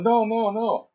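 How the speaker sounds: high-pitched quick protest no, no, no; mimicking biker. Imitating the biker shaking his head, high-pitched. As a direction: wave it off in a high squeaky voice. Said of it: "He was like".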